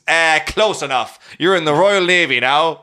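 loud put-on officer's announcement; press-gang navy recruiter. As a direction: bluster like a recruiting officer waving it through. Press-gang officer enlisting a man.

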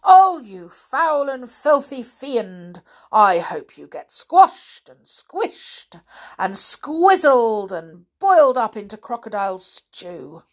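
loud, slow, high-starting tirade; theatrical elephant's scolding; outraged curse. Acted. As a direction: relish every insulting word indignantly.

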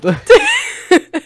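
sudden high burst of laughter; high loud laugh. Real, not acted. Reader laughs at own dark line, loud high burst. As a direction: crack up loudly and high.

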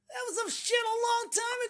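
high, whining mock excuse; whiny mock excuse. Mocking a cancelled man's whiny excuse, pitched high. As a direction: whine it like a petulant offender.

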